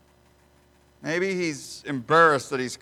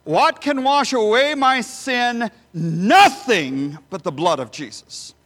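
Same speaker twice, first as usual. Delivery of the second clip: preaching at full force; 8 semitones higher, 7 dB louder than usual. Acted. Preacher to congregation, full-force rhetorical preaching. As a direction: raise the hall with each answer.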